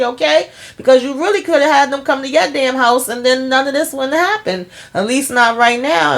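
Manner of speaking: high, scolding rant at Karen; exasperated rant. Retold or imitated. Friend scolding Karen to reveal the pregnancy, high exasperated rant. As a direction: scold her to spill it already.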